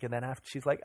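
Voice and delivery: usual speaking voice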